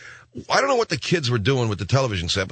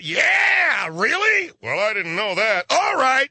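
mock TV talk voices; 13 semitones higher, slower than usual. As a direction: squeal like chatty hosts.

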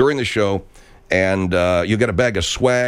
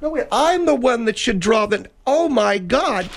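high put-on voice; 12 semitones higher than usual. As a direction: protest like a squeaky upset kid.

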